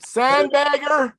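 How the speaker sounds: high, loud, drawn-out holler; retold shout, stretched and high; hollered accusation. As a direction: holler it out like a heckler in a crowd. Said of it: "He hollers".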